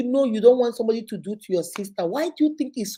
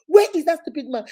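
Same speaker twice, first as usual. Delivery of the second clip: higher, louder angry demand; louder mock anger; 5 semitones higher, 6 dB louder than usual. Brother threatening sister's husband, louder. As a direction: demand the man angrily.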